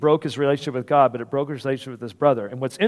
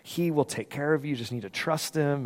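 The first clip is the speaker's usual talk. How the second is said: soft, lowered reassurance; hushed, tender retelling; gentle encouragement; 3 semitones lower, 9 dB quieter than usual. Retold or imitated. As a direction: quietly console someone distraught. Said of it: "tried to encourage him".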